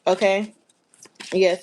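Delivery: ordinary talk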